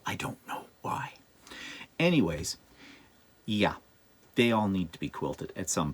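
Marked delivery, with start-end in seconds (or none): 0.0-1.1 whispered aside to herself. Real, not acted. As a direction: breathe it out as a whisper. Aside after hiccup complaint.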